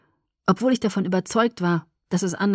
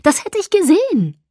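higher, louder indignant retort; 11 semitones higher, 7 dB louder than usual. Acted. Alice defending herself to Edward, indignant. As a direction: snap back in protest.

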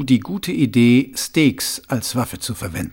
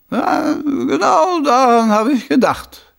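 higher, slow, wheedling put-on voice; a scheming noble, savouring his idea; sly, high-pitched lordly voice; scheming Lord Schnappüber; 4 dB louder, slower than usual.

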